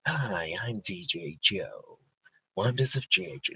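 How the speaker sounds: hesitant, soft, slow impression; half-hearted impression of a radio DJ; attempt at DJ Joe. Host trying out a colleague's voice, badly imitated. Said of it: "I don't think I'd be good at him".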